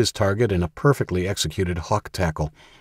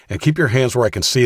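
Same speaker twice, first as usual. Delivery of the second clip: loud, higher yelled command; yelled order; 6 semitones higher, 5 dB louder, faster than usual. Acted. Danny yells at Dawson to keep his hands visible, loud yell. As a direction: bark it at the man you hold at gunpoint. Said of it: "yelled Danny".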